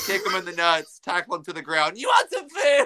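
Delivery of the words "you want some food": high mock yell. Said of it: "look at somebody like".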